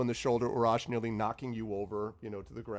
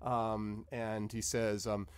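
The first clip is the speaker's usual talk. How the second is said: ordinary talk, nothing marked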